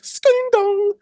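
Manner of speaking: very high sing-song store chime; imitated door chime. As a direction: mimic an electronic door bell. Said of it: "I literally heard, like".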